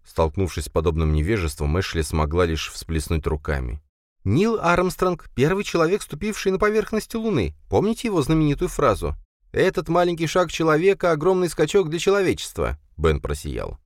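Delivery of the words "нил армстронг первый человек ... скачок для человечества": exasperated woman voice. A narrator's reading. Ashley explaining, exasperated.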